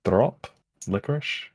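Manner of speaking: normal talking voice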